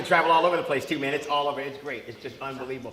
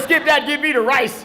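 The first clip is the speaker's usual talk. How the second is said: high, loud mock exasperation; snapping; 6 semitones higher, 5 dB louder than usual. Diner gives up on potato with waiter, mock exasperated snapping. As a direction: cancel the order in a comic outburst. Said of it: "Finally, I snap".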